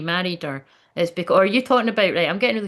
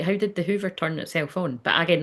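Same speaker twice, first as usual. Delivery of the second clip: usual speaking voice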